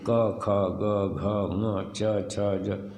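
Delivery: क, ख, ग, घ, ङ, च, छ, ज spelled out very slowly; slow teacher's alphabet drill; slow recitation. Shown as a demonstration. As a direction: drill the consonants syllable by syllable. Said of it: "सबका उच्चारण बताओ".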